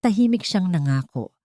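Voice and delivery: plain reading voice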